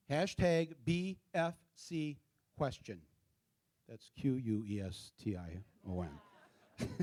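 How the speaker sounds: slow, soft letter-by-letter dictation; slow spelling-out. Real, not acted. Speaker gives the audience a hashtag to type, slow spelling-out.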